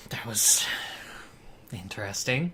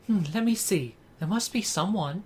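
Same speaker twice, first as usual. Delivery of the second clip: high, thoughtful character voice; game character voice; 7 semitones higher than usual. Acted. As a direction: think aloud in a raised, youthful-cartoon pitch. Reading game character's dialogue.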